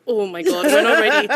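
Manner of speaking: loud, rising mock panic; imitated scared husband. Wife imitating husband at first pregnancy news, comic panic. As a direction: panic as him, half laughing. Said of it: "he's, like, so scared".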